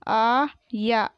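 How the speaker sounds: आया split into long aa and yaa; very slow आया, syllables held apart; spelled out. Shown as a demonstration. Teacher sounding out आया for pupils, syllable by syllable. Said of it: "मैंने इस तरीके से पढ़ा".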